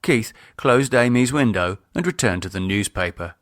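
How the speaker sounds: ordinary delivery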